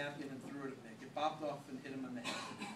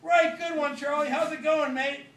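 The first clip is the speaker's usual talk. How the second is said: much louder and higher shout; Ringo yelling back to Charlie, loud cheerful shout; yelled back; 12 semitones higher, 16 dB louder, slower than usual. Acted. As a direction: call back from the loo door. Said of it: "yelled back".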